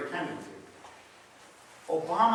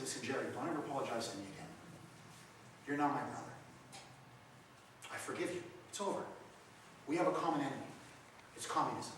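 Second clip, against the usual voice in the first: gentle forgiveness; 7 dB quieter than usual. Retold or imitated. Forgiving the weeping ex-communist.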